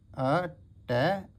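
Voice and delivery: अटैक stretched very slowly, rising at the end; slow teaching pronunciation; drawn-out demo. Shown as a demonstration. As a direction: sound out अटैक syllable by syllable. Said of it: "वही आ रही है sound अटैक".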